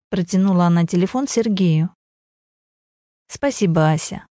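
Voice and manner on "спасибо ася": calm, level, showing nothing; even voice. Acted. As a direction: keep it flat and composed. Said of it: "ровным голосом произнёс Сергей". (ровным голосом)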